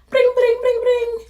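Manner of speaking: high, loud ring-ring imitation; phone ringing. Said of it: "I would probably call".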